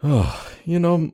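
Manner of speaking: normal talking voice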